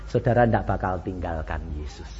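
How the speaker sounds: normal talking voice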